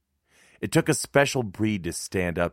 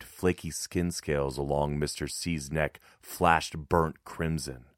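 usual speaking voice

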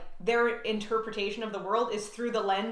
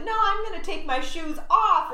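put-on high, playful child voice; high-pitched kiddie joking; 9 semitones higher than usual. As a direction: imitate a little girl teasing. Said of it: "she was making a joke".

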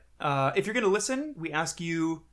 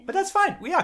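laughing; 7 dB louder than usual. Real, not acted. Laughing after friend's email.

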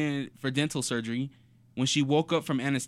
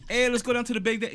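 higher-pitched New York street talk; imitated New York accent; 9 semitones higher than usual. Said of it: "very New York type of thing".

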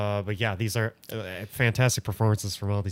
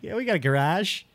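higher pitched Boston accent impression; 7 semitones higher than usual. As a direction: put on a Boston local accent. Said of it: "a thick Boston accent".

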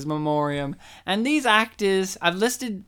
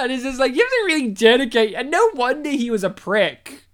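ordinary talk, nothing marked

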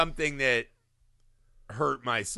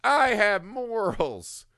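slow, mock-earnest proclamation; lightly voiced pompous son; hammed-up solemn claim; mock noble voice; 7 semitones higher, much slower than usual. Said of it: "he is the".

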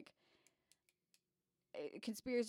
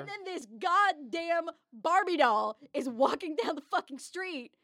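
higher, louder and slow, hammed-up outrage; mock indignant rant; 7 semitones higher, 9 dB louder, slower than usual. Nerdy character fuming at the pretty girl, hammed-up higher louder rant. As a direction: drag it out like a scandalised nerd. Griping in character about the Barbie doll.

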